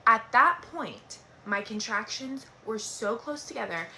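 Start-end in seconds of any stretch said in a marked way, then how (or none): none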